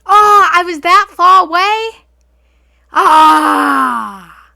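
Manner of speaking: high, loud startled cry; shocked shout. Real, not acted. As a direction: shriek in real surprise. Shocked at hearing herself.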